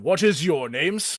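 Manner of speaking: a hulking orc sizing them up; big orc Mountain. Acted.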